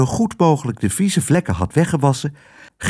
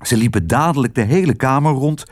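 ordinary delivery

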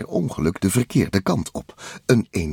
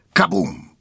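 high "kaboom"; explosion exclamation; 10 semitones higher than usual. A narrator's reading. Narrator voicing the oil exploding, high punchy exclamation. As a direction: burst out the blast word.

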